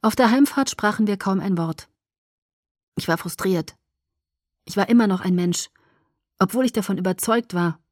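usual speaking voice

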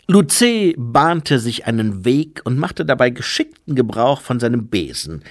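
ordinary delivery, nothing marked

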